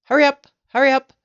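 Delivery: loud, high and quick; brisk, high-pitched call to hurry; urgent cry. A narrator's reading. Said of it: "cried Mugglewump".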